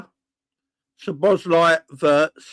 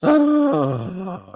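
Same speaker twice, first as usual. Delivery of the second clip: frustrated groan; 3 semitones higher than usual. Real, not acted.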